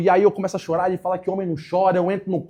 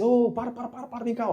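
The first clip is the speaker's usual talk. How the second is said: calling out; 7 semitones higher than usual. Retold or imitated. Calming a worried student.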